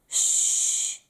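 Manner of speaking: sharp whispered shush; dad shushing his daughter. Acted. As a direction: hush her sharply. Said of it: "said Dad".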